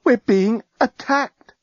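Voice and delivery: slow frightened disbelief; stunned, slow dread. Acted. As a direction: let the fear sink in. Stunned realisation of attack.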